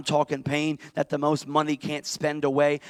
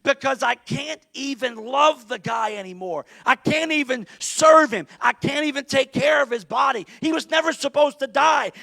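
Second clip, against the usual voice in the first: Mary's raised, desperate wail; emotional panic; 6 semitones higher than usual. Acted. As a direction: cry it out in rising panic. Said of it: "erupts into an emotional panic".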